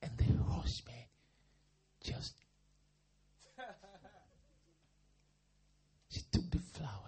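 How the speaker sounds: breathy hushed storytelling; whispered suspense. Real, not acted. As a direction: drop to a whisper for the reveal.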